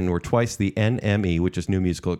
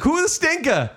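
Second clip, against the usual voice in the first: mock bafflement. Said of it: "So I was like".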